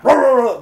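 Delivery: high, loud growling roar like a big dog; playful imitation of a dog's roar; mock dog roar. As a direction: bark it out like the German Shepherd.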